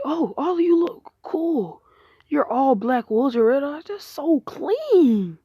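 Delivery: high-pitched, drawn-out admiring gush; awed admiration. Acted. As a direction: gush over how cool they look, stretching the words. Naruto admires the wolves, acted.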